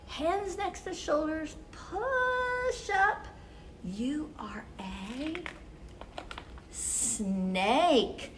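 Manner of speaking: ordinary delivery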